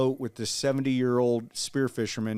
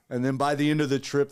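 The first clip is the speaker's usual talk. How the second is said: ordinary talk, nothing marked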